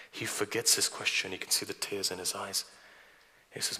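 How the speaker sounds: ordinary talk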